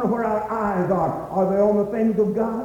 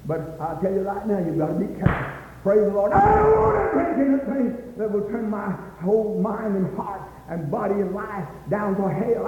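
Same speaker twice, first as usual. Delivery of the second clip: ordinary delivery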